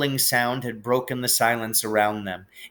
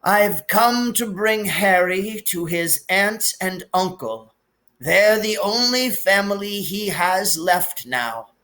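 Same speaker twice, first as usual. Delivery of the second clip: higher, slow and measured; gentle sorrowful old wizard; wise old headmaster, lightly voiced; 8 semitones higher, slower than usual. A narrator's reading. Dumbledore figure held.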